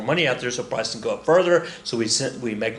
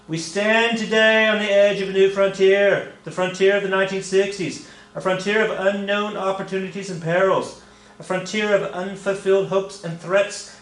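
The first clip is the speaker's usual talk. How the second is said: orate it like Kennedy at the podium, a bit too Irish; Kennedy speech voice; 6 semitones higher than usual. Retold or imitated. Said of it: "that was maybe a little too Irish".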